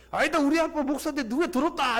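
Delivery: put-on high childish boast; pastor's son retorting. 목사 아들이 장로 아들에게 맞받아치는 높은 아이 목소리. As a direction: play a kid insisting his dad wins.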